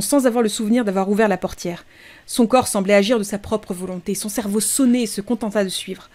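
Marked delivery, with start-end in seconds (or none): none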